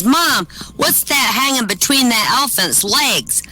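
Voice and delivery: put-on high-pitched little boy asking; curious young son. Son to his mom at the circus, curious. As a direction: wonder aloud like a small child.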